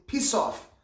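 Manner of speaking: high, loud swear phrase; emphatic show-off cursing; forceful insult. Acted. Shows off a learned insult.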